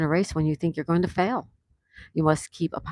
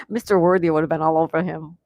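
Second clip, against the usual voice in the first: ordinary talk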